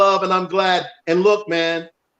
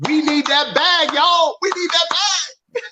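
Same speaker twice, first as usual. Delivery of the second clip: hyped up; 10 semitones higher, slower than usual. Real, not acted. Fundraising hype, calling out.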